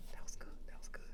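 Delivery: a barely audible whisper; whispered praise. Retold or imitated. Coach whispering approval to Parsons after his act.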